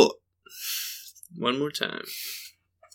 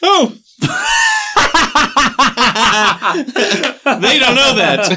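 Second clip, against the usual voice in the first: laughing; 6 semitones higher, much slower than usual. Real, not acted. Laughing through the words.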